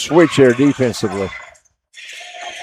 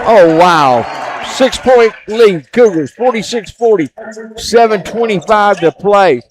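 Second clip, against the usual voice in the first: excited call; 7 semitones higher, 5 dB louder than usual. Real, not acted. Call right after the big three.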